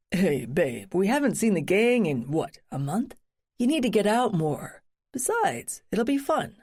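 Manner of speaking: lightly voiced breezy young man; easygoing husband coaxing his wife; casual husband voice. Narrator voices husband Brad.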